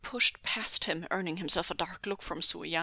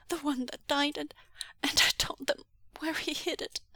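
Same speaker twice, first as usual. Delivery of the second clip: high, halting, tearful voice; distressed, broken phrases; sobbing confession; 8 semitones higher than usual. Acted. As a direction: stammer out a guilty confession. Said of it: "Xuanyu sobbed".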